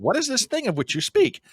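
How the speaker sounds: high-pitched, stilted puzzlement; mock bafflement. Young listeners puzzled by paper maps, mock bafflement. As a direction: play someone who has never heard of this thing.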